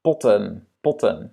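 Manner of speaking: Potten with a short first vowel. Shown as a demonstration. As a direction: keep the O of potten brief. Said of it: "Il va être court". (« potten » avec un O court)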